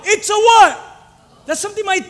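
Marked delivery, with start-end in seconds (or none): 0.0-0.8 loud, high, panicked cry; frightened cry. Acted. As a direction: yell it out in fear. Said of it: "they cried out".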